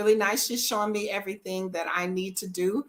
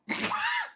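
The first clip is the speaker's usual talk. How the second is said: sudden high burst of laughter; 4 dB quieter than usual. Real, not acted. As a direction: crack up at the joke. Laughing at sergeant joke.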